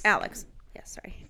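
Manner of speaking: whispered, embarrassed correction; sheepish whisper. Real, not acted. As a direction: whisper the fix, caught out. Correcting a name slip.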